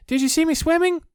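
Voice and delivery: very high, loud, excited squeak. A narrator's reading. Roo telling everyone about his swimming, excited high squeak. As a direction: squeak it bursting with pride.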